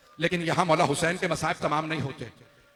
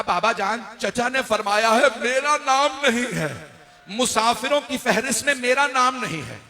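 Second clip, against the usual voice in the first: distressed appeal; 7 semitones higher, 5 dB louder than usual. A narrator's reading. Left-out daughter appeals to father.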